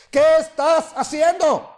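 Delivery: slow, loud and high demanding question; loud first call. Shown as a demonstration. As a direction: call out firmly as God's first warning. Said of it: "primero es fuerte".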